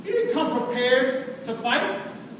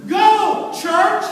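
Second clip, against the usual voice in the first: loud, punched rallying cry; rallying shout; 6 semitones higher, 7 dB louder, much slower than usual. Acted. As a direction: call the troops to charge. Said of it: "In other words, David was saying".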